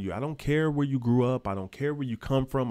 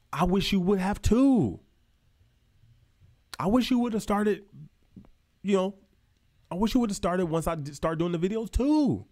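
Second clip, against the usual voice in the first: fired-up frustration; 7 semitones higher than usual. Real, not acted. Creator scolding his viewers for not starting, higher and frustrated. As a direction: vent at them for waiting too long. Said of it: "getting pissed off".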